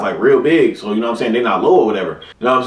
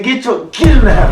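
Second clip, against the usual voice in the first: higher, louder ordering mom; mad mom voice; 9 semitones higher, 5 dB louder than usual. Shown as a demonstration. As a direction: bark it like a mom who is fed up. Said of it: "picture in your head when they mad".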